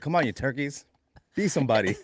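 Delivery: mock coach, gently goading; coaxing the market along; gentle coach. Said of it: "you be like".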